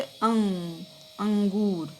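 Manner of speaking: अंग, अंगूर with a drawn-out nasal vowel; nasal demonstration. Shown as a demonstration. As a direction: hum the nasal in अंग, अंगूर through the nose. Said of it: "नाक से बोले जाते हैं".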